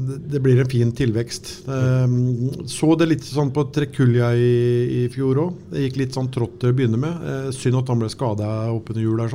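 usual speaking voice

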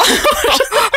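high burst of laughter; real amused laugh. Real, not acted. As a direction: laugh at yourself. Laughs at own story.

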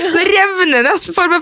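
laughing through the words. Real, not acted. Telling her co-host about the homework, laughing. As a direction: crack up while saying it.

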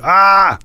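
high-pitched cry of pain; short demonstrated yelp, pitched well up; acted yell. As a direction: cry out as if caught in a lock. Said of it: "og jeg roper".

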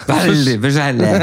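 high, slow, drawn-out mimicry; mock insistence. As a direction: repeat it high and stretched, making fun of it. Mocking repeat of the friend's insistence. (høy, langtrukken, ertende etterligning)

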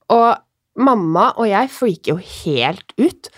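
ordinary delivery

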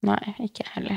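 breathy unvoiced whisper, softly. Real, not acted. Co-host whispers a quiet agreement. Whispered reply in podcast chat.

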